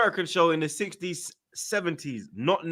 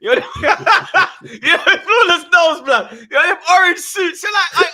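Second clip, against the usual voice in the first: high, loud laugh-through talk; giggling through the words; 11 semitones higher, 8 dB louder than usual. Real, not acted. Joking with friends about uncles' orange suits, laughing.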